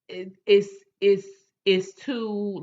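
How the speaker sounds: normal talking voice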